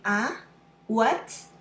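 "Ah" and "what" both lifting at the end; questioning rise. Shown as a demonstration. When you ask a question, rising second tone. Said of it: "It sounds like a, asking a question".

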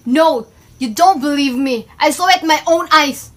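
loud, forceful protest; heated insistence. Acted. Upset partner insisting she saw that girl, heated. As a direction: push back hard, raising the voice.

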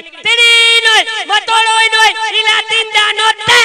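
high, loud, slow lisping woman; mother scolding in a comic lisp. Retold or imitated. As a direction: belt it out high with a thick lisp.

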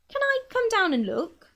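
eager shout down to the lady below; shouting back. Acted. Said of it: "Mister Hoppy shouted back".

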